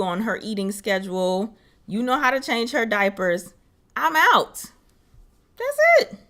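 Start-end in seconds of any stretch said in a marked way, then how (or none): 3.9-6.2 high bouncy sign-off; breezy I'm-free exit; playful sing-song. Acted. Playful leaving act.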